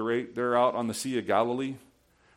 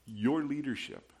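soft, low, hushed prayer to the Lord; quiet prayer; 6 semitones lower, 7 dB quieter than usual. Retold or imitated. Pastor models a quiet prayer to God, soft and hushed. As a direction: pray it gently, almost under your breath.

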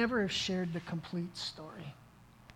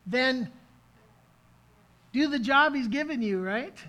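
preaching; 6 semitones higher, 9 dB louder than usual. Acted. Preaching opening exhortation.

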